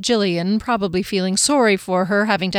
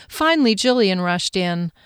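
plain reading voice

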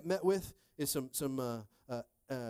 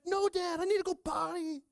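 put-on high-pitched, desperate toddler wail; imitated whiny child; two-year-old son; 5 dB louder than usual. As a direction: plead loudly like a little kid. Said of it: "we have a two-year-old".

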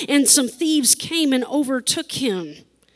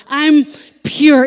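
I'm set apart, louder and higher; stress on I'm; 4 semitones higher, 12 dB louder than usual. Shown as a demonstration. Pastor playing with impure, stressing I'm. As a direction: lean on I'm so it can't be heard as impure. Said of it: "where you put the emphasis on which syllable".

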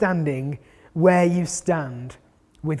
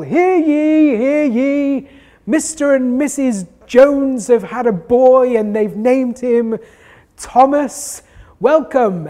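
town crier; 3 semitones higher, 5 dB louder than usual. Retold or imitated. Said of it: "roping in the town crier to walk the streets, ringing his bell".

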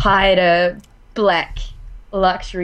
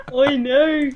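New Zealand accent; 6 semitones higher than usual. Shown as a demonstration. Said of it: "That's a New Zealand one".

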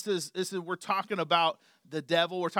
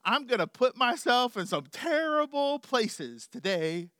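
mock confession; 7 semitones higher, 6 dB louder than usual. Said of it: "They didn't ever say".

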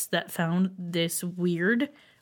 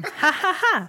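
mock Chef John laugh; 7 semitones higher than usual. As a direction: lightly mimic a chef's canned laugh. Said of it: "Chef John talks so".

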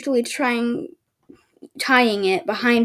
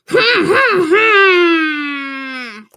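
high, loud, over-the-top cartoon humming; cartoon character; 6 semitones higher, 5 dB louder than usual. Reader mocks the book's hmm words with a cartoon voice. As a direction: ham it up like a cartoon. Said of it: "over-exaggerated cartoon character".

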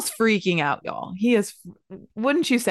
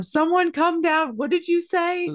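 frantic yelling; 10 semitones higher than usual. Retold or imitated. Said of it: "yelling at them".

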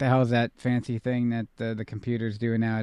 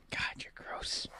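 whispering; 15 dB quieter, slower than usual. Real, not acted. Disgusted aside to co-host.

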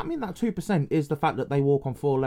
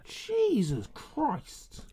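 stunned; 9 semitones higher, 9 dB quieter, much slower than usual. Real, not acted. Shocked again.

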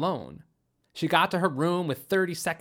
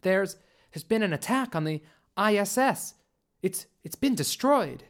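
halting, higher-pitched report of bad news; stammered shock; 5 semitones higher than usual. A narrator's reading. Carly reporting an attack on the ISS, shaken. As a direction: falter as the disaster sinks in.

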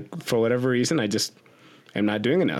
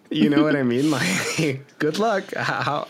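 laughing; 4 semitones higher than usual. Real, not acted. Laughing at dieters' tricks.

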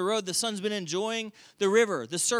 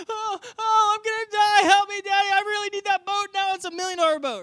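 high, loud mock-panicked wailing; whining child; 13 semitones higher, 6 dB louder than usual. Son begging Daddy on day two, whining. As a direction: ham up a son's desperate plea. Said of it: "he's whining, he's complaining".

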